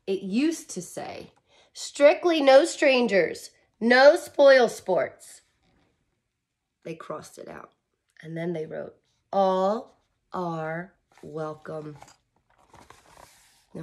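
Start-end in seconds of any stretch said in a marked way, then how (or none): 1.7-5.4 slow, loud, stern sign reading. Acted. Reader voicing the strict club sign. 9.3-12.0 warm welcome. Acted. Reading the new sign.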